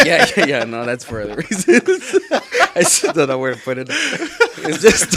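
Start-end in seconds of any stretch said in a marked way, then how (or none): 0.1-3.9 loud laughing reply. Real, not acted. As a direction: laugh off the tease. Laughing at teasing.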